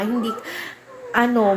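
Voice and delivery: ordinary delivery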